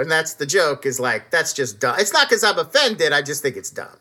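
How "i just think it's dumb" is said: ordinary talk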